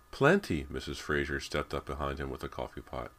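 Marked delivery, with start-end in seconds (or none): 0.1-0.7 much higher and louder, bright offer; cheerful. A narrator's reading. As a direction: sing it out as she pours. Wife offering coffee.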